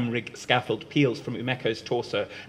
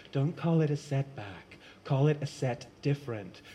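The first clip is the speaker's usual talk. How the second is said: softer, calm reframing; soothing reassurance; 6 dB quieter than usual. Acted. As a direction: gently talk them out of despair. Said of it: "soothes Ash".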